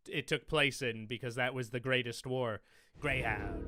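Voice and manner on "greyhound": mock trailer title.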